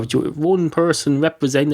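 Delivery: usual speaking voice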